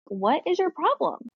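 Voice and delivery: a parent at the end of her patience; exasperated. Retold or imitated. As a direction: snap back at the crying kid. Mom annoyed at crying daughter.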